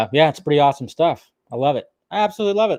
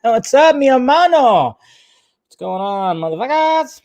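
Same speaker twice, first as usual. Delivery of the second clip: high and louder buddy shout-out; excited greeting; 9 semitones higher, 4 dB louder than usual. Real, not acted. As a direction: call out to your brother with a grin. Streamer greeting a viewer.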